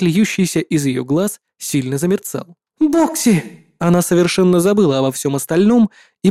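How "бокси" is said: high delighted cry; joyful call. Acted. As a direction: call out with sudden joy. Joyful call, runs to hug.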